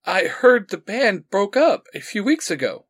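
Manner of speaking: piecing it together in disbelief; in shock. A narrator's reading. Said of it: "still slightly in shock".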